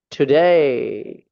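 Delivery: टुडे drawn out very slowly, clear t; slow model Today. Shown as a demonstration. Teacher models टुडे for students, very slow. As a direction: stretch टुडे out slowly for the class. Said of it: "देखिए, सबसे पहला word".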